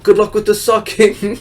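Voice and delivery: high, clueless Italian-flavored wrestler voice; Santino Marella impression. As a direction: play it as a naive, cheerful misunderstanding, pitched well up. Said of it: "Santino Marella not understanding".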